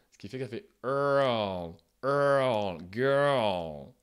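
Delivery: put-on girl with a small central vowel between er and L, slow; hidden vowel. Shown as a demonstration. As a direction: slip a tiny central vowel before the L in girl. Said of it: "une voyelle très centrale".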